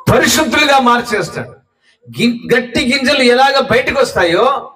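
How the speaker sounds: high-pitched, driving sermon delivery; impassioned preaching. Acted. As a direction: preach it urgently, pitched well up. (ఉద్వేగంగా, హెచ్చు స్వరంలో ప్రసంగం)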